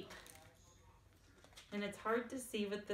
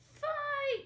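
high, loud battle cry; yelled; 4 dB louder than usual. A narrator's reading. Ramen the noodle yells to start the fight, loud and high. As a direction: yell it to start the brawl. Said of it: "yelled Ramen".

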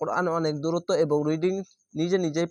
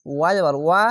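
usual speaking voice

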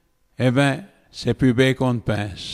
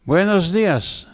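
higher, slower joyful hello; joyful greeting; 6 semitones higher, slower than usual. A narrator's reading. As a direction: greet the visitors with joy. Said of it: "dit-elle les femmes joyeusement".

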